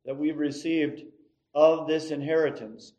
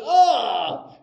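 a loud yell of pain, higher; mock pained yell; 8 semitones higher, 7 dB louder than usual. The preacher himself after the head clash, a loud mock yell. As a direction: holler like your bell just got rung. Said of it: "And I was going".

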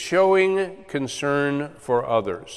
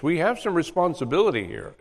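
ordinary talk

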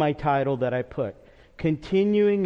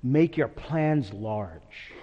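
solemn advice; 5 semitones lower, 3 dB quieter, much slower than usual. Retold or imitated. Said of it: "said this as he turned to his boys".

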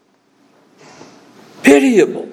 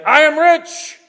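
bragging; 8 semitones higher, slower than usual. Retold or imitated. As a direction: crow it out proudly. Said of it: "They were bragging about this".

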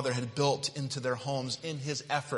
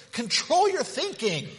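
exhortation; 12 semitones higher, 4 dB louder than usual. Acted. Preacher exhorts, then repeats.

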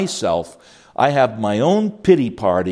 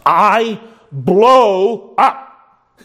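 loud, very slow mock outburst; blowing up; 9 semitones higher, 6 dB louder, much slower than usual. Parent admitting to the pastor, mock loud outburst. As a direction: let it burst out slowly, hammed-up. Parent answering about their children.

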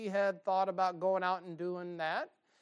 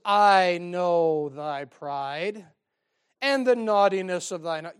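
slow, loud, sneering accusation; scornful older brother; 7 dB louder, slower than usual. Acted. Preacher voicing Eliab scolding David, slow and loud. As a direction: drag out "I know thy pride" like a jealous brother. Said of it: "Eliab says".

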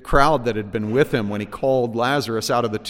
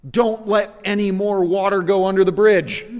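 higher, louder urgent plotter; urgent schemer; 9 semitones higher, 8 dB louder than usual. Retold or imitated. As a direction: press the plan hard.

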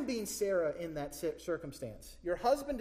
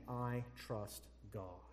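quiet trust; 7 semitones lower, 9 dB quieter, much slower than usual. Acted. Voicing a wife's quiet trust.

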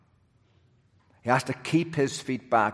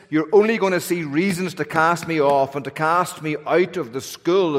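louder, higher pleading to God; fervent preaching; 5 semitones higher, 5 dB louder than usual. Acted. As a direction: preach it hard, begging for mercy.

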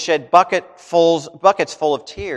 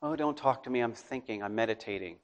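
much softer, dreamy mock musing; soft, self-absorbed mock meditation voice; mock introspective; 4 semitones lower, 13 dB quieter than usual. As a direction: lightly parody someone lost in thought, near a murmur. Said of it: "these people who walk around".

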